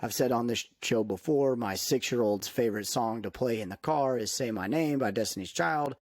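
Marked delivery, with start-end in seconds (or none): none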